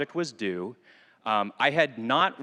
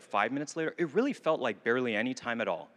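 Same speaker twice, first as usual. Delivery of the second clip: ordinary talk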